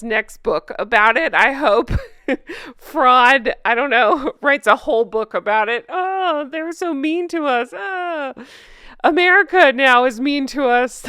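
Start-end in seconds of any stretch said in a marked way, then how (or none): 5.9-11.0 high, whiny mock complaint; mock whine. Mocking the couple complaining America is mean, high whine. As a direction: whine like a self-pitying victim.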